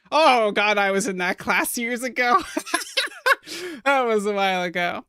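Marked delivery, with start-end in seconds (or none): 2.4-3.8 high-pitched burst of laughter; amused laugh. Real, not acted. Streamer recalls a class from years ago, amused laugh. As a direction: laugh at how time has flown.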